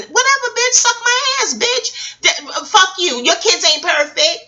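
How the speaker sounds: high, taunting retort; mock bus-stop insults. Strangers trading insults at the bus stop, high mock taunt. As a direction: hurl it back at the stranger, mocking.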